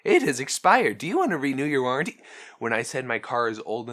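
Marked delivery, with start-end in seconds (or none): 0.0-2.2 quick, higher scripted sales pitch; rushed scripted telemarketer. Retold or imitated. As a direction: rattle off the robocall script. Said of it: "I got that on camera".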